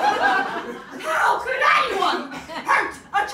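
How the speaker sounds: very high, slow, hammed-up wail; impression of mum. Mum gazing at newborn granddaughter, mock high drawn-out wail. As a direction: wail it loud, stretching every word. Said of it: "she looked down in her face and she went".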